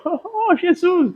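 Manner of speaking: high, mock-teary cry of "Jesus"; imitating teary prayer. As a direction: lightly imitate an old man crying out to Jesus. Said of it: "Eu vejo lá ele chorando". (imitando oração chorosa, aguda)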